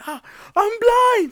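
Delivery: high, loud, drawn-out wail; imitating a drunk man's blind panic; mock panicked scream. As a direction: scream it like a hammed-up victim. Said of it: "He starts going".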